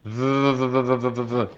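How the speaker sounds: v held with lips on teeth, buzzing; vibrating v drill. Shown as a demonstration. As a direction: teeth on lip and buzz the v. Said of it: "produciendo una vibración".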